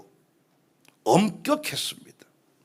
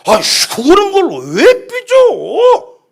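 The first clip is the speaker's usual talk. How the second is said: loud, high, drawn-out gripe; exasperated anger; 11 semitones higher, 10 dB louder, slower than usual. Acted. Annoyed believer griping about a touchy person, exasperated. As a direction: swoop up in slow, irritated disbelief. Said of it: "화가 나잖아요".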